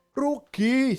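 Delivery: rugi said high and loud; mock haggling. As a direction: echo the seller's complaint. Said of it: "saya bilang".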